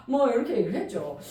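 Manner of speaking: normal talking voice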